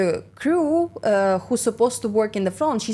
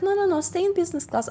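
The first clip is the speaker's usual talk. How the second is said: fast insistent senior; 10 semitones higher, much faster than usual. Retold or imitated.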